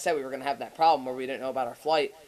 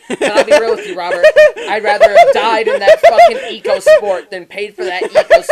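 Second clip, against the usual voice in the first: much higher and louder, laughing hard; laughing rant; 17 dB louder than usual. Real, not acted. Friend recounting a near-fatal ride, loud laughing rant. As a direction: rant at full volume through laughter.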